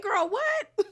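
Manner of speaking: very high, laughing disbelief; incredulous. Retold or imitated. Host reacts to a castmate's divorce claim, incredulous. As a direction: squeal it in amused shock.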